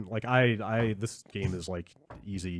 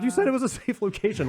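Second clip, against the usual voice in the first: high-pitched acted complaint; hammed-up outraged whine; mock indignant protest; 11 semitones higher than usual. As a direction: protest it like someone cheated, playfully. Acted complaint at the game's promise.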